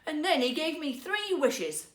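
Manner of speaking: sly. Acted. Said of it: "said her husband slyly".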